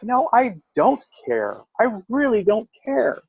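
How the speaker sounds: high-pitched, drawn-out retold retort; insistent indifference. Telling the ex off, exaggeratedly unbothered. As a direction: stretch it out, pitched high and dismissive.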